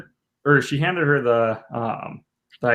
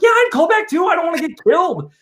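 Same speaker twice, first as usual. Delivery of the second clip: much higher, louder joking yelp; mock yell; 3 dB louder than usual. Husband joking he'd call back too, mock frightened yell.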